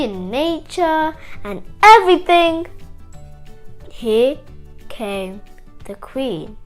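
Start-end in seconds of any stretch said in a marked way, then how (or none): none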